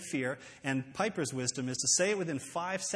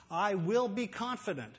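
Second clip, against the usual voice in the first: raised, emphatic vow; decided; 5 semitones higher than usual. Shown as a demonstration. A woman deciding not to give in to temptation, resolute. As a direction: declare it with firm resolve. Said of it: "This is a woman deciding that she's not gonna give in".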